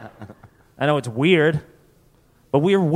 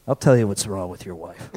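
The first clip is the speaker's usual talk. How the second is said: low, booming self-important; pompous drunk mayor; 9 semitones lower than usual. Acted. A drunk mayor answering the townsmen, deep and pompous. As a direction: pronounce it like the town's drunk mayor.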